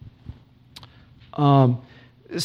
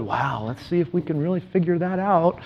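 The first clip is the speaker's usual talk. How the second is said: hushed mock wonder; mock awed listeners; 8 dB quieter than usual. Preacher imitating listeners puzzling over big words, softly mock-awed. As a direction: play the impressed crowd, much softer.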